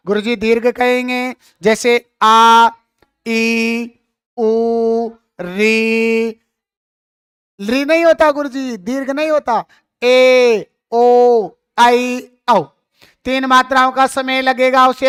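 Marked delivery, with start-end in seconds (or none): 2.2-6.3 आ, ई, उ, री each sustained evenly; long two-beat vowels. Shown as a demonstration. Teacher gives examples of long vowels, आ to री each drawn out. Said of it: "दो मात्राओं का समय". 10.0-12.6 ए, ओ, आई, औ each held briefly; long two-beat vowels. Shown as a demonstration. Teacher finishes the long-vowel list, ए to औ each lengthened. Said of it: "दो मात्राओं का समय".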